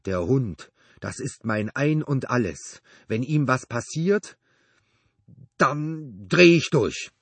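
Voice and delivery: loud, slow menace building to a high outburst; uncontrolled threat. A narrator's reading. Neighbor warning about his dog, threat boiling over. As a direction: build from emphatic warning to drawn-out, unhinged eruption. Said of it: "wurde eine unbeherrschte Drohung".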